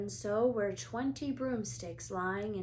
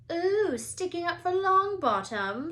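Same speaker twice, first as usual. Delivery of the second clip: put-on much higher, louder girl's taunt; 10 semitones higher, 8 dB louder than usual. A narrator's reading. Pansy taunts Parvati in a high, loud voice. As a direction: tease her mockingly.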